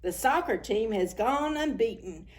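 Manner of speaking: ordinary delivery, nothing marked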